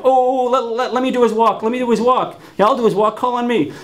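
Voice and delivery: high, eager begging to be picked; mock eager classmates shouting to volunteer; eager guys. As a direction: pitch it up like boys waving their hands. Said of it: "they're like".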